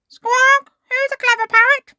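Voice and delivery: very high, loud, quick squawky bird voice; parrot mimicry. A narrator's reading. Polly the parrot copies Granny Pig, shrieky parrot voice. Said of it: "Polly copied".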